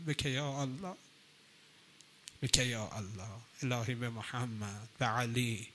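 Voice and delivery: soft, low supplication; hushed prayer. Acted. Preacher leading the congregation in prayer, soft and low. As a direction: plead quietly to God.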